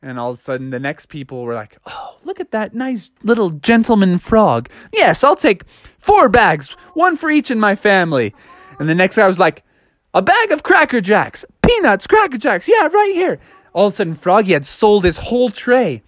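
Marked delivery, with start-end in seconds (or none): 1.9-8.3 higher-pitched cooing patron; delighted buyer voice, pitched up; charmed customer. Acted. Customers admire the little frog. 10.1-13.4 high-pitched shout for the vendor; high, eager fan voice; customer calling out. Acted.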